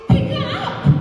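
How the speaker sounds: the tired mom barking instructions; shrill-free loud impatient command; exasperated mom. Retold or imitated. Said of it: "And then the mom says".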